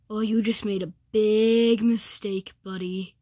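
low, ominous warning; mock tough-guy menace; menacing threat. Threatens the one who insulted him.

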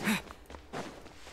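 sudden high breathy gasp; a startled intake of breath; sharp gasp. Real, not acted. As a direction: suck in air in quick surprise. Reacts to something on screen.

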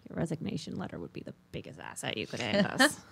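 soft, low muttered jab; under the breath. Acted. Annoyed player to the mayor, muttered under the breath. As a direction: mutter it so the mayor barely hears. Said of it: "under my breath".

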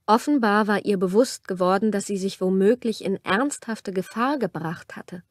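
usual speaking voice

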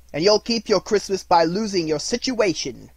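loud, high, angry warning; bullying employer's outburst; threatening. A narrator's reading. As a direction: warn the clerk off furiously. Scrooge threatens his clerk.

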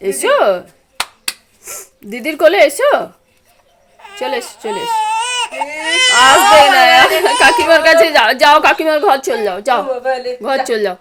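put-on high, loud sing-song coaxing the baby; loud, lilting baby talk to the infant. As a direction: coo brightly up at the little one. Mother coaxes the baby.

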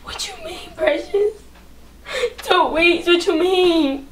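tearful disbelief. Acted. Girl hearing her dad is dying, tearful disbelief. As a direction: barely get it out through tears. Learns dad has two weeks.

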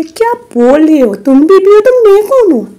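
outraged wife shouting back at the rival; angry confrontation. Acted. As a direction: rise to a sharp, offended challenge. Wife confronts mistress angrily.

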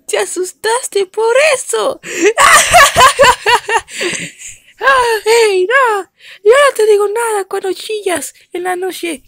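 high, loud mocking laugh then whiny protest; laughing, teasing dog. Dog laughs at the scared dog, high and loud. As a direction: tease the scared one. Dogs mock and argue.

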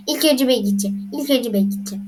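ordinary talk, nothing marked

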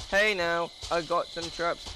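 normal talking voice

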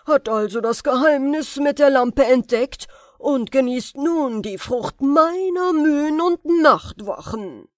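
höher und viel lauter, wütender Zauberer; voller Wut. A narrator's reading. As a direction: zornig herausgeschleuderte Drohung. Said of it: "Voll Wut sagte der Zauberer". (Zauberer tobt über Aladins Glück, wutentbrannt)

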